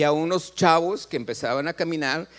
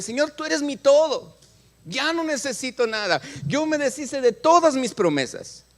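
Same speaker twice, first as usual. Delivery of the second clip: higher, louder fervent prayer; retold devotion; 8 semitones higher, 3 dB louder than usual. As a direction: declare it to God with fervor. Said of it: "diciéndole". (oración fervorosa, más aguda y fuerte)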